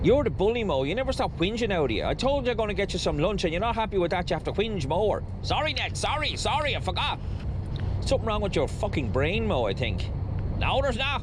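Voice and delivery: high, squawky squabbling characters; squabbling pair in high put-on voices; bickering comic duo. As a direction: act out a petty back-and-forth in high swooping voices. Acts out bickering Ned and Mo.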